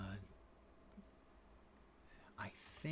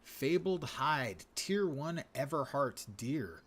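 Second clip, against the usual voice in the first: loud, slow grand announcer read; 5 semitones higher, 8 dB louder, slower than usual. Pretend. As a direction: proclaim the item name slowly. Reading item name with grandeur.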